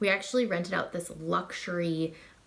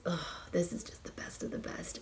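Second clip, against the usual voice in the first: hushed, much softer whisper; awed whisper; 9 dB quieter than usual. Real, not acted. Reviewer swooning over the best vanilla to viewers, whispered. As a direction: breathe it like a confession of love.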